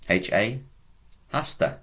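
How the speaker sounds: ordinary talk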